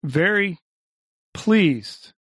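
hesitant, halting politeness; uncertain. A narrator's reading. Chairman greeting a delegation of thieves, uncertainly. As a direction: draw the words apart, unsure of yourself. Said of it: "the chairman said uncertainly".

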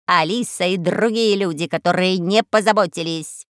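raspy cawing crow. Acted.

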